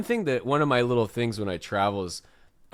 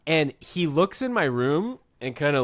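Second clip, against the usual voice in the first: usual speaking voice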